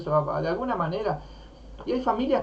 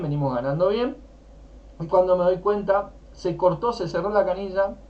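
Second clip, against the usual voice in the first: usual speaking voice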